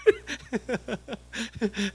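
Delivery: soft chuckle after an impression; laughing. Real, not acted. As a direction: chuckle quietly at your own mimicry. Laughs after mimicking the greeting.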